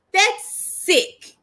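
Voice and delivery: high, slow, drawn-out outrage; outraged disbelief. Real, not acted. Reacting to fans bullying an idol, high slow outrage. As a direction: stretch it out in shocked disgust.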